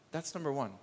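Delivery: normal talking voice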